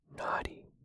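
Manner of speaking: whispered gasp of mild horror; horrified gasp. A narrator's reading. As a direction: gasp the word, mildly scandalised, still whispering. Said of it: "gasped Benny, with a horrified look".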